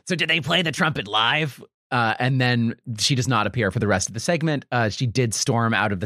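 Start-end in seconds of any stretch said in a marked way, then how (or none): 0.1-1.6 higher-pitched actress character voice; lightly acted interview question, pitched up; voiced actress. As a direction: put on a lifted voice for the actress's question. Voices retired actress in retold interview.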